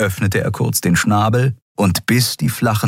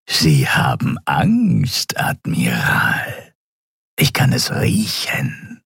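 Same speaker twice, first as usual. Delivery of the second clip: low, slow, half-whispered creature voice; menacing Draxyl; 8 semitones lower, slower than usual. A narrator's reading. Captive reptile taunting the frightened admiral, low slow rasp. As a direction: taunt slowly from deep in the throat.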